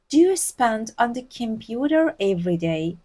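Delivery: very slow, careful English; slow model reading. Shown as a demonstration. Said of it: "Spend سپری کردن".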